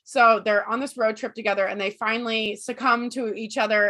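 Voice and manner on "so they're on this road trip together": normal talking voice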